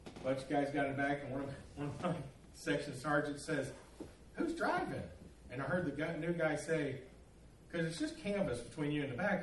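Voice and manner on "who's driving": high-pitched questioning call; imitated sergeant's query. Section sergeant in the back asking the driver, high-pitched call.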